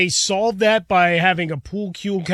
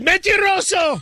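high-pitched drawn-out retort in Spanish; playful, theatrical rebuke; mock accusation; 10 semitones higher than usual. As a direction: imitate a mother calling out a liar. Acts out mother calling him liar.

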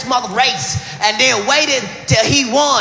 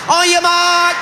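race starter call; 8 semitones higher, slower than usual. Acted. A race official calling the runners to the line. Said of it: "race starter call".

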